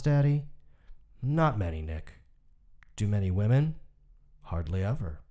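measured, somber reading of a short story; story narration. A narrator's reading. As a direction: read it like an audiobook narrator, even and grave. Reads a Hemingway story aloud.

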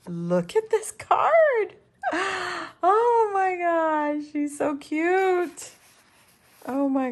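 high, slow squeal with a gasp; overcome with delight. Real, not acted. Crafter showing viewers a card, overcome with delight. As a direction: squeal and gasp, stretching the words.

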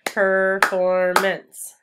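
"Performance" very slow and loud, syllable by syllable; loud, chanted syllable breakdown of "Performance"; clapped syllables. Shown as a demonstration. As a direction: stretch each syllable of "Performance" evenly. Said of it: "Let's clap the syllables in performance".

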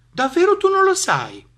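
high, loud incredulous question; mock astonishment. Mouse to the clueless snake, astonished. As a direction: act amazed at such ignorance.

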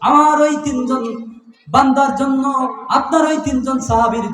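high proclamation, easing off at the end; impassioned preaching. Acted. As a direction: proclaim it to the crowd, then settle.

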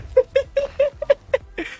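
squealing high-pitched laugh; shrieking laugh. Real, not acted. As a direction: burst out in a high cackle. Laughing at the play.

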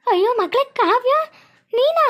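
high, drawn-out cry of disbelief; astonished cry. Acted. Mother seeing her daughter thought dead, astonished.

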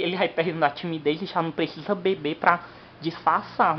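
ordinary delivery

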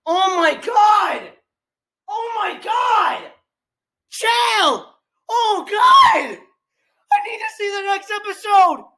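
high, drawn-out wails of disbelief; distraught yelling. Real, not acted. Fan desperate to see the next episode, distraught yelling. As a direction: wail it like an overwhelmed fan. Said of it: "wondering why I'm yelling".

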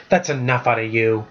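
usual speaking voice

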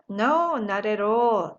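very slow, drawn-out reply; slow model answer. Shown as a demonstration. Teacher modelling the answer very slowly. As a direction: stretch each word for learners. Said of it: "또는".

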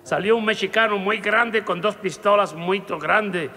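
normal talking voice

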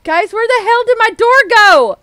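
high, loud baffled outcry; loud alarm. Real, not acted. Player asking viewers where the door went, loud alarm. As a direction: cry out in disbelief to the viewers.

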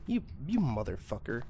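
ordinary delivery

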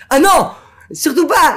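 high, loud cry of refusal; alarmed shout. Retold or imitated. Angels refusing the tzaddik at the gate, shouting. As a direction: burst out in alarm.

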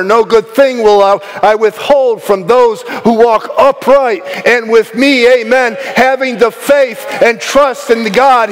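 high-energy sermon, ringing out promises; fervent preaching. Real, not acted. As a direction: preach it with rising conviction and punched key words. Preaches at full force.

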